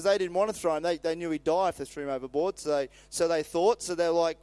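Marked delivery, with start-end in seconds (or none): none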